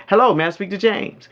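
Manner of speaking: high, projected phone greeting. Shown as a demonstration. Coach modelling the first line of a sales call, confident and lifted. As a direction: open the call brightly assertive, voice lifted. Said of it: "a little louder".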